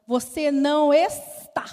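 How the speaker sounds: slow, loud, irritated denial; irritated retort. Retold or imitated. Annoyed doctor snaps back at the patient, loud and slow. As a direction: shut her down, drawn out and loud. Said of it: "Ela já muito irritada".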